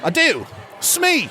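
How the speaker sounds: put-on Australian accent leaping up high on the last word; second Australian caller. [name] offers the contest word, high Australian accent. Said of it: "two completely separate accents".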